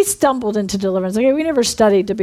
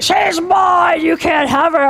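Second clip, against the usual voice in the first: shouted demon imitation, higher pitched; demonic man's voice; 6 semitones higher, 4 dB louder, slower than usual. Devil talking through Irene at the ministers, shouted demonic voice. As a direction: roar it possessively like a devil. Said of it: "literally a man's voice".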